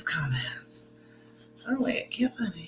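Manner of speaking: soft whisper under her breath; a hushed aside while scrolling comments; whispered to herself. Real, not acted. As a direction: whisper it as if reading to yourself. Checking comments, repeats word.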